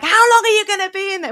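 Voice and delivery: high, loud, fast shout through a door; impatient yell. Retold or imitated. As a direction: yell at her husband on the toilet. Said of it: "she's banging on the door".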